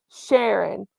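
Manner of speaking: ordinary delivery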